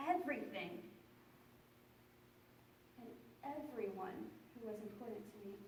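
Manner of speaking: low, soft and desolate; subdued sorrow. Acted. Friend admitting they lost everyone important to them, quietly sad. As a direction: let the voice sink away as the loss lands. Monologue turns to lonely grief.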